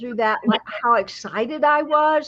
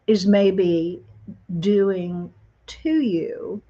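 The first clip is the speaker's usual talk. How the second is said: guided meditation; 4 semitones lower than usual. Acted. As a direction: ease them into calm, unhurried.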